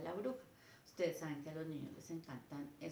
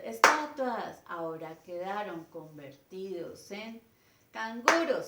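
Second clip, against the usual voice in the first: announced loudly; 9 dB louder than usual. Shown as a demonstration. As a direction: call out canguros to the children. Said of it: "esos tonos que yo hago".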